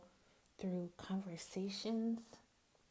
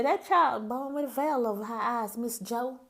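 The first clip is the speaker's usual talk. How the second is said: loud, raised Southern country-elder voice; Southern accent; 4 semitones higher, 10 dB louder than usual. Retold or imitated. Country folks telling Miss Joe about the child, loud Southern accent. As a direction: call it out in a strong Southern accent. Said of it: "hear my southern accent come out".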